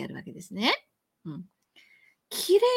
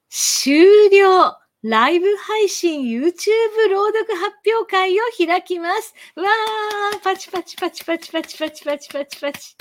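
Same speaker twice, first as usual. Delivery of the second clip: excited announcement; 9 semitones higher, 6 dB louder than usual. Acted. Announcing the recital, mock applause.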